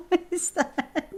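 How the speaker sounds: high, slow words through giggles; laughing. Real, not acted. Player baffled by the gun, laughing hard. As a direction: giggle helplessly through the question.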